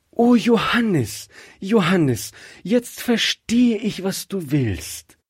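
high, drawn-out exclamations of dawning understanding; very excited. A narrator's reading. Rebekka suddenly understands her husband's wish, very excited. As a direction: burst out excitedly, pitch soaring. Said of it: "Rebekka war ganz aufgeregt".

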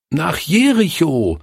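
put-on high eager chorus of children calling. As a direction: call it out like a room of kids. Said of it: "riefen sie".

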